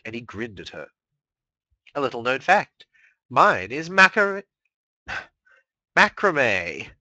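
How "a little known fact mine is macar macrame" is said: mock confession. Discord confides his flaw.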